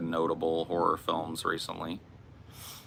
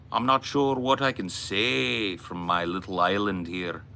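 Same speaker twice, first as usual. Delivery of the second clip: put-on Irish accent; 3 semitones higher than usual. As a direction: play a theatrical Irishman, swooping up high on key words. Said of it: "talk like an Irish".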